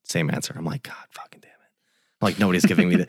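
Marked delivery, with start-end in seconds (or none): 0.8-1.6 very soft, voiceless retold swearing; whispered curse. Getting the same answer from another call, whispering. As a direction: hiss it quietly to yourself.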